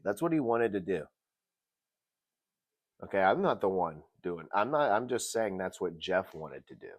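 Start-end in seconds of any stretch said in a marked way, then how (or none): none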